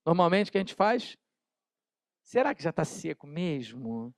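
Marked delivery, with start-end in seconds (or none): 2.2-4.1 sneaky hushed wondering. Pretend. Tempted to touch wet paint.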